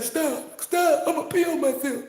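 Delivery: high, laughing plea to stop; laughing friend. Retold or imitated. Friend begs the joker to stop, high and laughing. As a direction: beg through laughter, pitched high. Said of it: "they be like".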